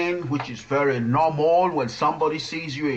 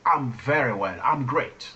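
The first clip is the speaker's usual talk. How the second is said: normal talking voice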